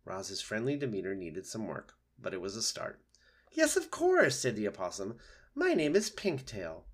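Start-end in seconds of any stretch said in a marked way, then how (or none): 3.5-4.4 high, eager opossum; obliging opossum. Acted. Opossum agreeing to give its name, high and eager. Opossum replies to polite robot. 5.5-6.8 lightly voiced, lilting opossum; a hint of theatrical friendliness; friendly opossum. Opossum introducing itself to robot, lightly lilting.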